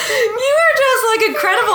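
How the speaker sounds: emotional laugh, words stretched out; laughing while choked up; laughing through tears. Real, not acted. Mother moved, laughing and teary.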